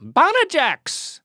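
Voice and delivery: high, drawn-out exclamation; mock yell. Shown as a demonstration. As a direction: try it out as a yelled expletive. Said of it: "Maybe it's something you yell".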